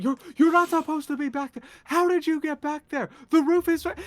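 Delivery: high, loud scolding yell. Acted. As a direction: yell in a high-pitched, indignant character voice. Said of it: "one of them is yelling".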